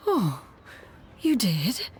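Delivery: a hushed, breathy whisper; quiet whispered question. Acted. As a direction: say it under your breath, moved. Master touched, hushed reply.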